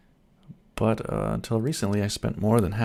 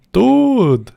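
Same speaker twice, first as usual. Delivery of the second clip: excited shout; 13 dB louder than usual. Real, not acted. Greeting a viewer excitedly.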